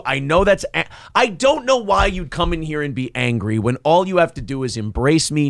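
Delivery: ordinary talk, nothing marked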